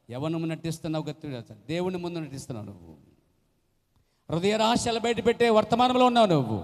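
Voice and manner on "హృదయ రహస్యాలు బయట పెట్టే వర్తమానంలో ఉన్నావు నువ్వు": loud, higher fiery warning; preaching shout. Real, not acted. Preacher thundering a warning at the congregation. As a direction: thunder it at the sinner.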